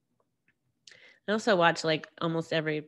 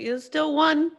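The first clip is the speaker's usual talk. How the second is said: louder, higher insistent comeback; emphatic retort; 7 semitones higher, 7 dB louder than usual. Retold or imitated. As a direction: push back hard, voice up and loud. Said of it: "I'm like".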